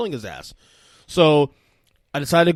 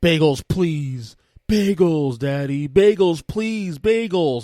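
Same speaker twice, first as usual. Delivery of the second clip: put-on higher, louder toddler begging, drawn out; imitated whining child; 4 semitones higher, 7 dB louder, much slower than usual. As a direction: plead like a small child for bagels. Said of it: "he starts crying. He wants bagels".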